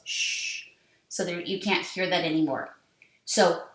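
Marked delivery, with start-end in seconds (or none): 0.0-0.7 a quiet unvoiced shh; soft shush. Shown as a demonstration. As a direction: hush it like a silent letter. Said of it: "It goes".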